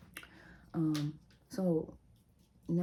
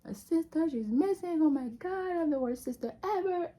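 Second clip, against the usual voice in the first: high, louder wailing cry, half-hammed; mock crying wail; 11 semitones higher, 4 dB louder than usual. Big sister blaming herself while her dad questions them, wailing. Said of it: "So now I start crying".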